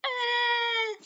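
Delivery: high, loud cry of despair; loud anguished cry; frustrated wail. Acted. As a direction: wail like all is lost. Despair over last place.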